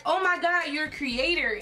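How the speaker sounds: high-pitched delighted discovery; excited surprise. Retold or imitated. Creator spotting a fan's badge, excited surprise. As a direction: exclaim it high, as if just noticing.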